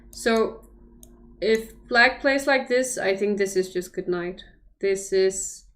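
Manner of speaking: ordinary delivery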